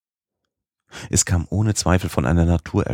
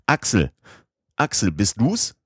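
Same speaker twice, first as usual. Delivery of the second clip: calling out; 6 semitones higher than usual. A narrator's reading. Uncle calls from far through rock.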